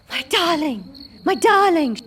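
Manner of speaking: high, desperate crying out. Acted. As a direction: plead in desperate panic. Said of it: "she cried desperately".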